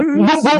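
high-pitched little boop noises; imitated boop-boop. Acting out a drive-by drop-off, high playful boops. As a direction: toot out two quick high boops.

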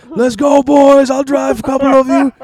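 put-on very high, loud drunken holler; shit-faced shouting. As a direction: yell like a wasted guy rallying his mates. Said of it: "like, shit-faced".

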